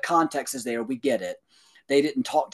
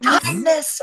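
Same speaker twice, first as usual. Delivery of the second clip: high, incredulous "Kindness?"; disbelieving, irritated echo; imitating exasperated disbelief, lightly; exasperated hoarse; 10 semitones higher than usual. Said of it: "almost like hoarse".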